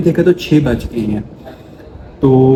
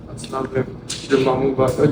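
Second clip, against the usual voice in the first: ordinary talk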